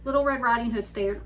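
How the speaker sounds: ordinary delivery, nothing marked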